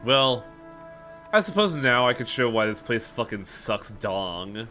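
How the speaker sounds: usual speaking voice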